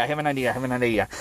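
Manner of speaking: "I have an idea" gabbled fast and soft; fast hushed rush. Shown as a demonstration. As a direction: rattle "I have an idea" off under your breath. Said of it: "repeats faster and quieter".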